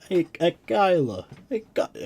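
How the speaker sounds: high, loud hammed-up New Yorker; New York accent. Streamer hailing Kyla, loud high New York accent. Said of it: "sorry, a New York accent".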